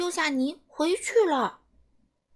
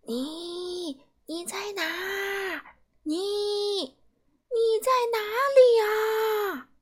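tearful calling; 8 semitones higher, much slower than usual. Acted. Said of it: "霸王龙流着眼泪大喊着".

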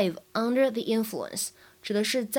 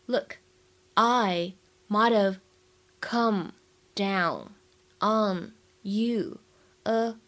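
slow, with a pause after each word or phrase; much slower than usual. Shown as a demonstration. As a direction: dictate it piece by piece for learners. Teacher models it word by word. (一词一顿地慢速示范朗读)

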